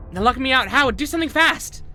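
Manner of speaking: high, loud urgent yell; panicked shout. Acted. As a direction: scream for help fast.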